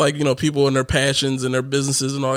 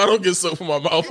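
ordinary delivery

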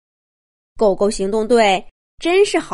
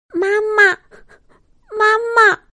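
high, tearful little monkey; weak child's cry; 11 semitones higher than usual. A narrator's reading. Trapped baby monkey calling mother, weak and tearful. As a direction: cry out for mother from far inside. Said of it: "一阵虚弱的声音".

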